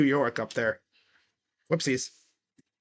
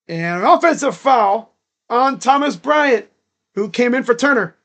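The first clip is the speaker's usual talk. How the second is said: higher, energetic foul call; live play-by-play; 6 semitones higher, 5 dB louder than usual. Real, not acted. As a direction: announce the foul to the listeners. Calls the game live.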